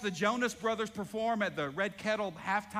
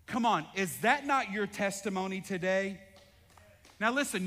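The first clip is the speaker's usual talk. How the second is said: ordinary talk, nothing marked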